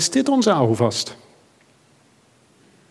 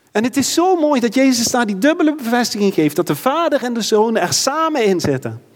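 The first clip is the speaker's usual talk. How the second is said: higher, impassioned preacher building to a peak; fervent preaching; 7 semitones higher than usual. Acted. Preacher urges the church to trust God's hand, fervent and high. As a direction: lift the congregation with rising fervour.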